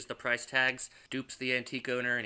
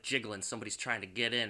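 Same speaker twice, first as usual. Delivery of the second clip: ordinary talk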